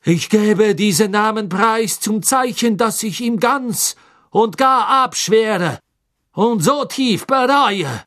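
hoch, gedehnt beteuernd; nervöser Karkaroff. Acted. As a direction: reuig bitten und beschwichtigen. (Karkaroff beteuert dem Gericht seine Reue)

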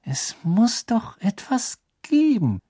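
gehauchtes, gedehntes Grübeln in hoher Lage; murmelnd. A narrator's reading. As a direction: murmle es langsam und hoch vor dich hin. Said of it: "murmelte Hermine". (murmelnd, hoch und gedehnt)

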